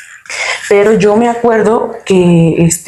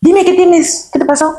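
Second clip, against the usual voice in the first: much higher, coaxing uncle voice; uncle pressing; 10 semitones higher than usual. Retold or imitated. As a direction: lean in and coax the answer out of her, pitched way up. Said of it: "y se me acerca y dice".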